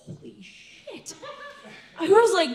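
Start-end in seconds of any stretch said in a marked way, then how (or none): 0.1-1.1 breathless, slow whispered shock; stunned whisper. Retold or imitated. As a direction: whisper it in disbelief.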